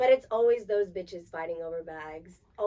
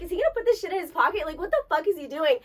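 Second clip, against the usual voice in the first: high, louder and fast, disbelieving; incredulous; 7 semitones higher, 5 dB louder, faster than usual. Retold or imitated. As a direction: rattle it off in shock. Incredulous acted inner thought.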